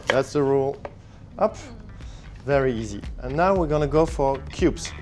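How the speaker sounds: ordinary delivery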